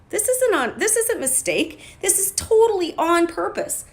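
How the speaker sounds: high, excited insistence that it was all on purpose; emphatic declaration. Real, not acted. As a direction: proclaim it with rising conviction.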